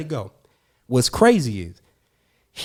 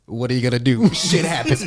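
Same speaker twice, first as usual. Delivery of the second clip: louder mock retort, close to own pitch; mengejek balik; 4 dB louder than usual. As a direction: throw the taunt straight back. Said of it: "What I do right back?".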